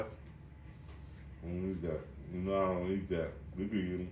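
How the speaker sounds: talking while eating; words round a bite of food; mouth full. Real, not acted. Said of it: "don't talk with your mouth full".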